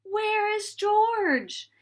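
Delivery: slow, high calling out over the water. A narrator's reading. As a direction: call out slowly for the lost monkey. Sailors searching for lost monkey.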